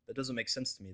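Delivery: usual speaking voice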